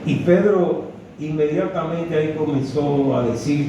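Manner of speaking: normal talking voice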